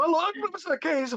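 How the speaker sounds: high-pitched mock student protest; student's surprised reply. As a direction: squeak it out like a startled kid. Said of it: "Aí o aluno".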